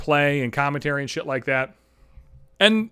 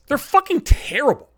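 angry rant; 9 semitones higher than usual. Real, not acted. Ranting about Buffalo News writers.

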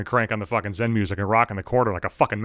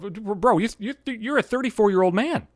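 high exasperated self-lecture, pitch way up; mock exasperated talking-to; self-scolding; 8 semitones higher than usual. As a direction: scold yourself in disbelief. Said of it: "I'm thinking to myself".

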